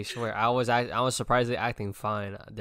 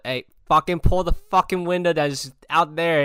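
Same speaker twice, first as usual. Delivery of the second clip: loud retold order, higher; retold yell; 6 semitones higher, 8 dB louder than usual. Telling Selena to roll the window down, loud. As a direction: bark it at her again. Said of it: "I literally told her".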